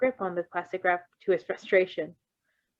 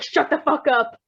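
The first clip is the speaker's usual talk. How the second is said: mock snap; 7 semitones higher, 8 dB louder than usual. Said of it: "Virgil's just like".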